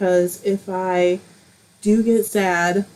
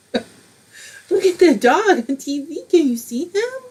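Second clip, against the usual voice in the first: doggy talk; 8 semitones higher, slower than usual. Real, not acted. Talking to her dog.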